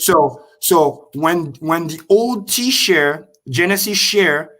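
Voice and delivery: very slow, deliberate teaching pace; slow explanation. Shown as a demonstration. Explaining share rate to the stream, slowly. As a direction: spell it out slowly for them. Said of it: "I'll say it again very slow".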